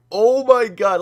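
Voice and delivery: high, loud mock-panicked outburst; freaking out inwardly. Customer silently reacting to the clerk's news, freaking out. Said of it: "I'm freaking out in my head".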